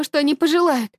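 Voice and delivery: ordinary delivery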